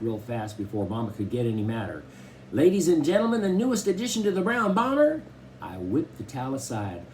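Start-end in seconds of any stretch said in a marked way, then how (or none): 2.5-5.2 high, grand showbiz announcement; mock announcer. A narrator's reading. Kenneth unveiling the record player, mock announcer. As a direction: announce it like a game-show host.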